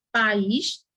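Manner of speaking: "país" split as pa-ÍS; low "país" rising on a separate í; hiatus, vowels apart. Shown as a demonstration. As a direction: pull the í away from the a in "país". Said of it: "o A ficou junto, é, distante do I".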